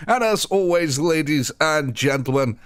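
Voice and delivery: high, drawn-out announcer's sign-off; big sign-off take. Shown as a demonstration. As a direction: stretch it out like a showman. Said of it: "we're gonna have a second one".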